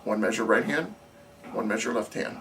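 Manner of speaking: ordinary talk, nothing marked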